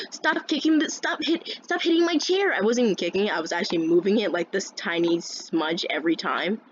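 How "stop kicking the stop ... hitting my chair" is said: high, insistent protest; acted annoyed classmate voice; retold complaint. Classmate to student bumping his chair, annoyed.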